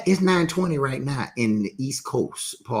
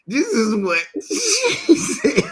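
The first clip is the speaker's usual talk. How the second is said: laughing; 12 semitones higher, slower than usual. Real, not acted. Amused, pointing to the text.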